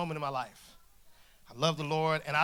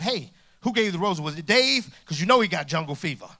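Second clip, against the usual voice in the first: indignant questioning; 9 dB louder than usual. Retold or imitated. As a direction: demand answers, half-joking. Acted challenge about the roses.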